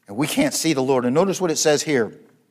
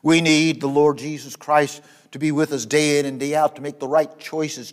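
ordinary talk, nothing marked

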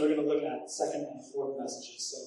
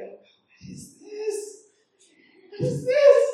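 disbelief; 8 dB louder, slower than usual. Retold or imitated. Acted disbelief at own work.

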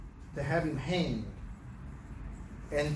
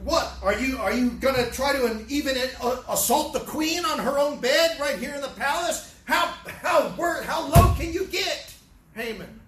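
higher and louder, rising in outrage; indignant king; 6 semitones higher, 4 dB louder than usual. Retold or imitated. King rebuking Haman for assaulting the queen, indignant. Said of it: "He's saying".

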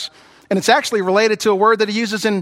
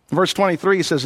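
normal talking voice

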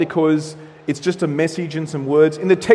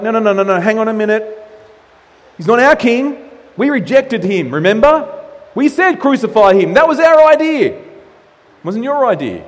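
louder, indignant no-no-no; miffed protest; 5 semitones higher, 5 dB louder than usual. Retold or imitated. Chief priests protesting to Pilate, loud and indignant. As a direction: push back hard, offended. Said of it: "They're a little bit miffed".

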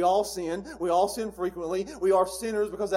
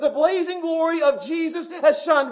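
higher and louder, building to a shouted peak; full-force preaching; 9 semitones higher, 10 dB louder than usual. Acted. Preacher telling the church they know Jesus, building to a shout. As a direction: ring it out to the back pew.

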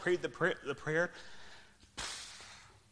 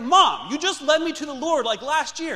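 put-on much higher and louder, a laugh breaking through, outraged kid; indignant protest; 13 semitones higher, 11 dB louder than usual. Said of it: "I was so mad at her".

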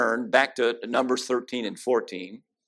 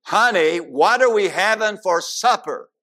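louder and higher, almost shouting the question; loud call; 6 semitones higher, 4 dB louder than usual. Retold or imitated. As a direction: raise your voice so she finally hears. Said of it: "He got a little closer".

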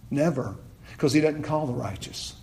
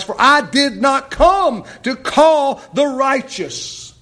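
slow, high, loud preaching; forceful raised-voice verse reading; thundering scripture; 10 semitones higher, 7 dB louder, slower than usual. Acted. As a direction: punch out each phrase, slowly and loudly.

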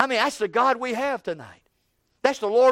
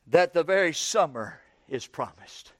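normal talking voice

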